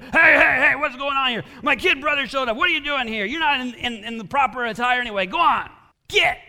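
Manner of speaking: loud, high-pitched barked orders; acted indignant hollering; shouting commander. Acted commander breaking up a tussle.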